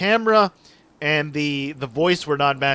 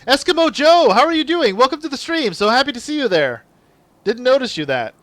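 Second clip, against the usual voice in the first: excited greeting; 10 semitones higher, 5 dB louder than usual. Real, not acted. Greeting a chat viewer on stream.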